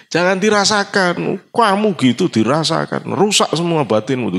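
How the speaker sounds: high-pitched, emphatic telling-off; retold admonishment. Friend warning a proud official about his pride, admonishing.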